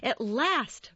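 high-pitched sarcastic exclamation. A narrator's reading. Mercutio greets the late Romeo, sarcastic. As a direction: greet the latecomer with mild sarcasm. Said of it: "Mercutio said sarcastically".

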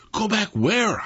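WHERE pushed up high in disbelief; questioning. A narrator's reading. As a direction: ask it with a high stress on "where". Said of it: "I questioned".

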